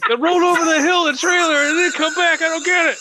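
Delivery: high squealing bewilderment; mock angry nerd. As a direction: protest in a hammed-up fanboy screech. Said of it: "a bunch of other angry nerds".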